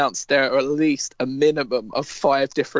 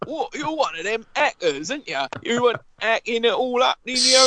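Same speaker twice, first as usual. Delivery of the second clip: aggressive Cockney; 8 semitones higher than usual. Retold or imitated. Imagined game character greeting a hacker in aggressive Cockney. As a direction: bellow it like a cheeky Cockney geezer. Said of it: "aggressively Cockney accent".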